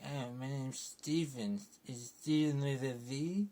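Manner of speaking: slow, high, exaggerated posh English accent; mocking Steven's odd English accent, drawn out. Reviewer mocking Moon Knight's Steven, in a silly English accent. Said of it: "what's with that accent?".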